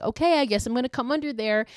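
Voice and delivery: high, quick mock child voice; imitated playful little girl. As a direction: pitch it up and say it fast, like a playful kid. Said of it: "she's like".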